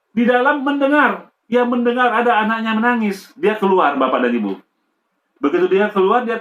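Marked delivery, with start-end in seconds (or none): none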